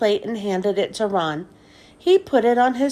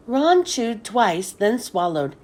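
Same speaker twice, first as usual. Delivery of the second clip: plain reading voice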